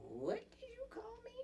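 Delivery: very high, soft incredulous question; indignant disbelief. Retold or imitated. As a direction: squeak it out, softly affronted. Acted comeback to an insult.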